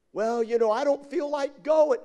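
high, loud mock complaint; whiny excuse. Churchgoer not wanting to go, high mock whine. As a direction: mimic a reluctant churchgoer dragging out an excuse.

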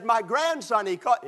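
imitating a boastful proud grandpa. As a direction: crow about your grandson. Proud grandpa voiced in story.

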